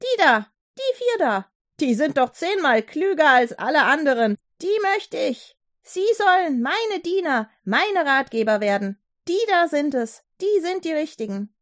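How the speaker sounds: the king calls out his choice, thrilled; excited king. A narrator's reading. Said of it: "rief der König aus".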